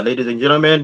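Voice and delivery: usual speaking voice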